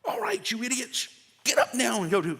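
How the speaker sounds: high-pitched bossy rousing; mock bossy order. Ordering the household out of bed, mock bossy. Imagining home talk caught on recording.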